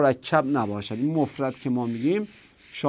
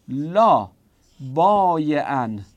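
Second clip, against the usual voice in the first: slow dictation; 3 dB louder, much slower than usual. Shown as a demonstration.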